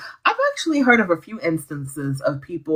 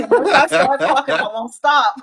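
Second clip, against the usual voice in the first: laughing; 10 semitones higher, 5 dB louder than usual. Real, not acted. Laughing at own talkativeness.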